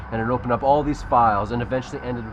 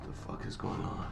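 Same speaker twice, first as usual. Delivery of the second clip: dazed whisper; 15 dB quieter than usual. Acted. Wakes up restrained.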